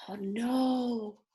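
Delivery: hushed mock alarm; whispered oh no. Reader to children about Mudge, whispered alarm. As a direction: whisper it like a secret worry.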